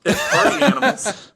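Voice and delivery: high, loud laughing repeat; laughing echo. Real, not acted. Host repeating co-host's quip, laughing hard. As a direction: crack up while echoing the joke.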